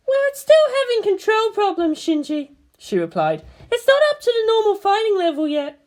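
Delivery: high woman's voice, briefing; voiced commanding officer. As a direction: report damage like an operations chief. Misato explaining.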